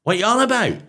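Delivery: high mock disbelief from the chippy woman; incredulous chip shop woman. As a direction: play her baffled and offended. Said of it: "She went".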